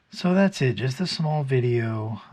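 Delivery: usual speaking voice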